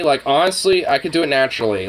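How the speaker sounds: ordinary delivery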